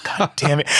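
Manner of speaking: muttered, mildly annoyed "God damn it"; half-joking annoyance; mock exasperation. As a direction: grumble it like a good loser. Teammates love the hummingbird logo.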